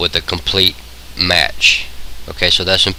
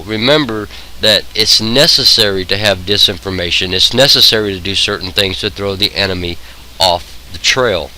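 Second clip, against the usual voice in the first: normal talking voice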